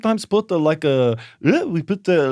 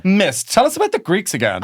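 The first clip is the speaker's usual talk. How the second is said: eager pupil; 6 semitones higher, 4 dB louder than usual. Retold or imitated.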